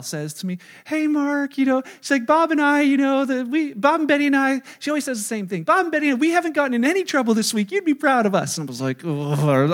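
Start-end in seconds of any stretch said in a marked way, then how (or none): 0.8-8.6 put-on light, cheery voice of a sweet older lady. June Udell chatting to Mark in the closet, a lightly voiced sweet lady. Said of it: "sweet, loving June Udell". 9.0-9.7 dismissive eye roll. Retold or imitated. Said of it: "eye roll".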